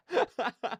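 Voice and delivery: a quick high laugh at herself; short laugh. Real, not acted. Streamer laughing about the alert sound scaring her, a short high laugh. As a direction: laugh off the fright.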